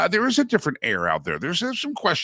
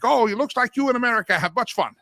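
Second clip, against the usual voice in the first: hammed-up imitation of an African friend's accent; playful, clumsy African accent quoting a friend; bad African accent; 10 semitones higher than usual. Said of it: "That, that's a bad accent".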